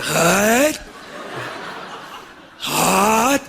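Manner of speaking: ordinary talk, nothing marked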